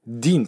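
"din" as D joined into in; linked din. Shown as a demonstration. Teacher isolates the linked syllable. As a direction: glide D straight into in. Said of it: "これがくっついて".

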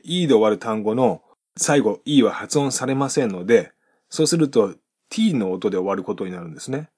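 usual speaking voice

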